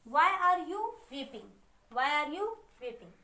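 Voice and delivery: slow, word by word English sentence; teacher dictating slowly; slow dictation. Shown as a demonstration. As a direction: read it out slowly for the class to copy. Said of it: "तो देखिए why, question mark".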